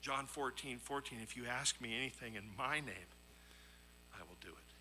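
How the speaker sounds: ordinary delivery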